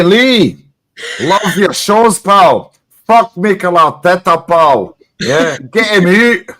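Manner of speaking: doing a broad Glaswegian mate shouting; Scottish accent, hammed-up and loud; Glaswegian impression. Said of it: "And he's like".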